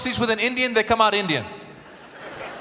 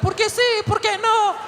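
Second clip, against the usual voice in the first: put-on much higher kid voices shouting back; kids responding; 13 semitones higher than usual. Kids answering nannies' song, high shouting kid voices. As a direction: answer like excited little kids. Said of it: "the kids were like".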